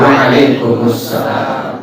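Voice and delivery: Waalaikumsalam slow and complete; every syllable of Waalaikumsalam; careful proper reply; correct full reply. Shown as a demonstration. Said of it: "Baru kita bilang apa?".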